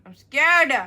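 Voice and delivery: playful fear; mock scared. Real, not acted. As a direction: squeal that you're scared.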